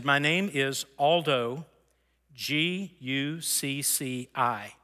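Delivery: slowly spelling out a name, letter by letter; retold slow spelling. Man spells his surname for the officer, slow and deliberate. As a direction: spell it out slowly, with measured gaps.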